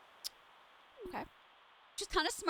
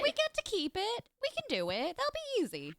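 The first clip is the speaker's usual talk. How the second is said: much higher, sing-song bravado; mock confidence; 9 semitones higher than usual. Hyping up the wood task, mock-confident. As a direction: fake it like nothing could go wrong.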